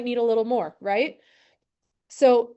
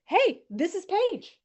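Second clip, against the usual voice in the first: higher, slow, put-on bright greeting; mock cheery introduction; 7 semitones higher, slower than usual. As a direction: act out the awkward intro, drawn out. Imitating his own awkward introduction.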